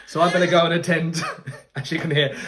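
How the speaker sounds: laughing while rushing the words. Real, not acted. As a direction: chuckle through it, hurried. Baby crying, he laughs.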